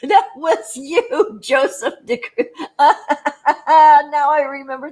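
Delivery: laughing through the words. Real, not acted. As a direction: giggle as you remember. Laughing while recognizing a viewer.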